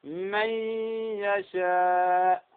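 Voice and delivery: "من يشاء" with ن melted into a long nasal ي, very slow; Ghunna-Demo. Shown as a demonstration. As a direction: merge "من يشاء" into a humming "mayy-yashaa", vowel stretched. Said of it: "وأمثلة على ذلك أيضًا".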